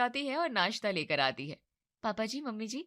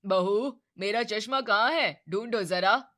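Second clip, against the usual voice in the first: louder, calling for the daughter-in-law; 5 dB louder, slower than usual. Acted. Father-in-law calls to daughter-in-law for his glasses, loud call. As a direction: call across the room for the glasses. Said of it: "Pradeep ने आवाज लगाई".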